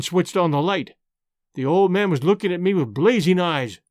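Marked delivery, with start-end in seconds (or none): none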